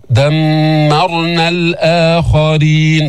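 دمرنا with a long nasal mim; clear ghunna. Shown as a demonstration. Teacher models verse, دمرنا with held nasal hum. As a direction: recite دمرنا slowly, humming on the doubled m. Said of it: "كمان ميم مشددة في غنة بينة".

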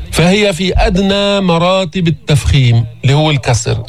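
ordinary talk, nothing marked

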